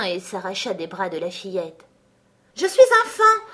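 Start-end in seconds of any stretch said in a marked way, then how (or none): 2.5-3.4 much higher and louder joyous cry; delighted cry. Acted. The fawn realizing what it is, crying out with delight. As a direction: burst out with delight. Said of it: "d'une voix ravie".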